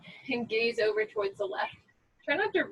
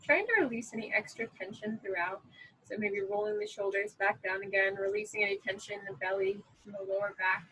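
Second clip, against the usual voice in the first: guided breathing meditation. Acted. Yoga teacher leading a breath count, calm guided meditation. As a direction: guide them calmly through each breath, unhurried.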